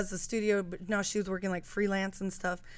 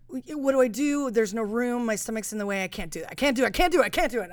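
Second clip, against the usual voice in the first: rising, louder panic; freaking out; 3 semitones higher, 4 dB louder, faster than usual. Retold or imitated. Stuck in a forward fold, rising panic. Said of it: "I was, like, freaking out".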